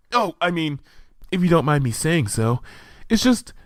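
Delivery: high, hesitant little voice; a shy pony working up courage; timid Fluttershy. A narrator's reading. Fluttershy to Luna, shy and hesitant.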